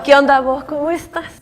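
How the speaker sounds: loud Salvadoran greeting; Salvadoran accent. Shown as a demonstration. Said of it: "El Salvador".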